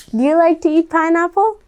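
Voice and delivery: ordinary delivery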